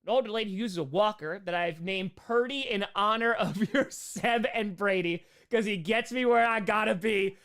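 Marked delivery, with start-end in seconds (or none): none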